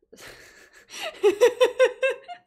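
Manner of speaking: high-pitched burst of laughter; laughing squeal. Real, not acted. Streamer cracks up in front of viewers, high squealing laugh.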